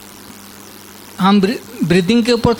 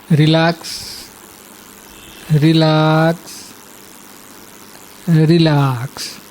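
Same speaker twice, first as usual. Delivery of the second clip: lower, measured meditation voice with long pauses; guided meditation; 4 semitones lower than usual. Acted. Teacher guiding listeners to relax and inhale, guided meditation.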